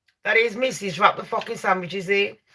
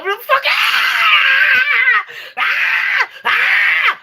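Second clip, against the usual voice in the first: long high mock-terror screams; panicked screaming; 3 dB louder, much slower than usual. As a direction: scream your head off in pretend panic. Imagining panic in the tunnel.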